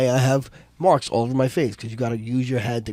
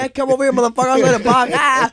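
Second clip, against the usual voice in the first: high, louder hammed-up challenge ending in a yell; playful mock threat; 11 semitones higher, 4 dB louder, faster than usual. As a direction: act out a rowdy comeback, pitched way up. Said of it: "but in my voice".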